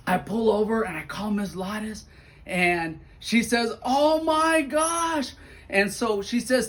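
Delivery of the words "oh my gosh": amazed gasp. Retold or imitated. Librarian hears the news.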